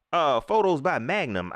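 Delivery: usual speaking voice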